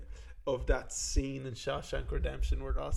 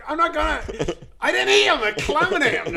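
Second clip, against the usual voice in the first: much higher, loud squeaky plea; mock prisoner plea; 13 semitones higher, 9 dB louder than usual. Prisoner pleads to guards in high squeaky voice. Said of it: "I'm like".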